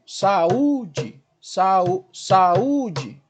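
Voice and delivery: "saúde" split as sa-Ú-de, stretched; hiatus demo. Shown as a demonstration. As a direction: separate A from a long stressed U in "saúde". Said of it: "na hora da separação silábica". ("saúde" separando bem as sílabas, sa-ú-de)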